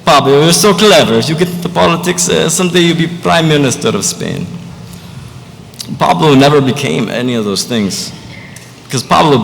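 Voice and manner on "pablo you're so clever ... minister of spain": gushing Spanish mother, higher voice; proud mama with a Spanish accent, lilting up high. Acted. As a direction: play a doting mom from Spain, Spanish accent. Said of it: "I tweak my accent a little bit".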